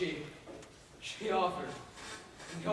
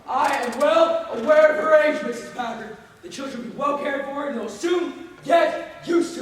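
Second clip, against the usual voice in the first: loud, high, furious reply; husband shouting at his wife, much higher and louder; angry husband; 6 semitones higher, 11 dB louder than usual. Acted. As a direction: shout her down.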